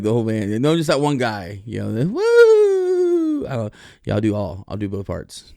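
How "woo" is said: a far higher, loud whooping cry; shrill-free falsetto whoop yelled out. Retold or imitated. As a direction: let out a wild rock whoop. Imitating the band guy's whoop.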